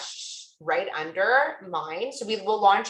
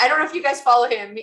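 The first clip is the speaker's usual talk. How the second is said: ordinary talk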